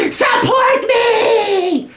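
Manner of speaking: high, slow shrill mock whine; mock a whiny entitled graduate; whiny mock protester. Mocking whiny liberal arts grads.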